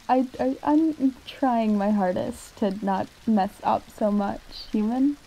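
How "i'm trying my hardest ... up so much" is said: ordinary talk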